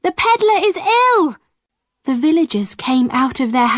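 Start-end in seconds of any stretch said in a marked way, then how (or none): 0.0-1.4 urgent call. A narrator's reading. Boy calling the villagers.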